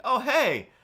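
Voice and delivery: loud high startled hello; surprised greeting. Retold or imitated. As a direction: greet her as an old friend. Surprised greeting to familiar face.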